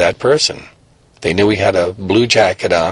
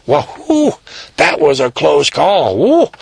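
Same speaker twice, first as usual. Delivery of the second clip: high whistling whew, slower after; relieved whew; 5 semitones higher than usual. Acted. Boy alone after losing the cops, high relieved whew. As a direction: blow out relief at a close call.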